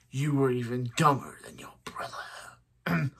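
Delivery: trailing into a villain's whisper; lightly voiced whispery villain; Zoom voice. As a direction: let it sink to a menacing hush. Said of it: "I do a very good Zoom voice".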